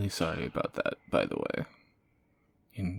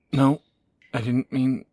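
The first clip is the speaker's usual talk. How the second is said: sudden loud, much louder panicked "No, I didn't mean"; panicked outburst; 5 semitones higher, 11 dB louder than usual. Acted. Horrified at her own slip, she loudly cries out to the listener in panic. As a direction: blurt it out in alarm, taking it back.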